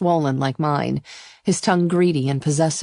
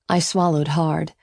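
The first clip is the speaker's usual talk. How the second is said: ordinary delivery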